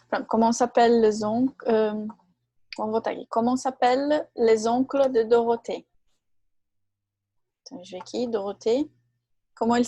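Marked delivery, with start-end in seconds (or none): none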